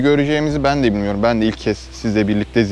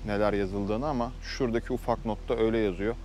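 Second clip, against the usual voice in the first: hushed; 10 dB quieter than usual. Real, not acted. Standing at the crash memorial.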